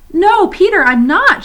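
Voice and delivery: high, loud, pleading denial; insistent protest. Acted. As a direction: plead to be believed. Lucy protests to Peter.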